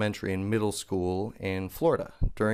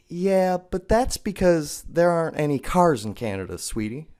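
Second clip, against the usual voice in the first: higher, sugary talking-down teacher voice; condescending teacher; 8 semitones higher, 4 dB louder than usual. Retold or imitated. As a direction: patronize the kid sweetly.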